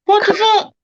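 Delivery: high, stunned yelp of disbelief; a new arrival reacts to students cursing the teacher: shocked high outburst. Retold or imitated. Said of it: "tongue hanging out shocked".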